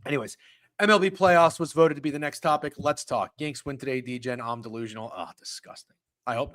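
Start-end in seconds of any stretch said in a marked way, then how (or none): 5.2-6.0 whispered disgust. Real, not acted. Reacting to Yankees win.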